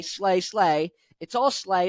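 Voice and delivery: normal talking voice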